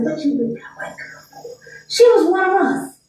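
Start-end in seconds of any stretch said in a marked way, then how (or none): 0.0-1.4 unvoiced church whisper; whispered gossip. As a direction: hiss it like gossip in the pews. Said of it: "one time anybody said".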